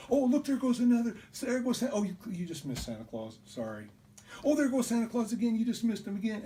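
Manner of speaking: high, loud, quick mock taunt; teasing. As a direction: needle the little sister with sing-song glee. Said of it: "He was teasing me about Santa Claus".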